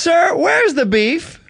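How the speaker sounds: put-on high, very slow drawn-out old woman; slow old lady catchphrase. Shown as a demonstration. Director demonstrating the catchphrase to the actor, high and drawn out. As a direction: stretch each word like a crotchety granny.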